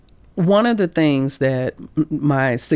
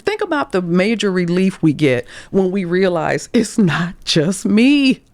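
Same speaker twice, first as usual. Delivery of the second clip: ordinary delivery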